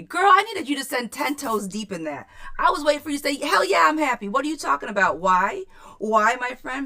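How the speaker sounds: loud, high, playfully indignant; hammed-up disbelief at a lukewarm answer; mock outrage. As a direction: pretend to be shocked she isn't thrilled.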